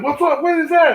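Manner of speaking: high, loud mock curious; Billy curious. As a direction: pop the question over a shoulder. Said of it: "And then".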